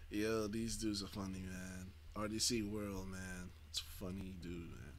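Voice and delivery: quiet laughing. Real, not acted. Laughing quietly after the clip.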